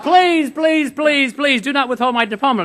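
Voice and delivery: high, pleading please please, hammed up; mock pleading. President imitating student begging for his diploma, mock desperate plea. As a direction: beg like a panicked student.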